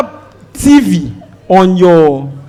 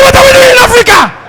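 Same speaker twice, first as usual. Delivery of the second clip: high, loud cry of disbelief; shouting and half crying on the plane; panicked shout; 14 semitones higher, 4 dB louder, faster than usual. Retold or imitated. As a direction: yell it in horror at the crew. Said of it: "Oga, you are shouting".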